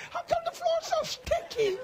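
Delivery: high squeaky puzzled question; mock puzzled parishioners. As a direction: imitate confused churchgoers through laughter. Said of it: "They like this".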